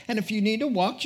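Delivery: usual speaking voice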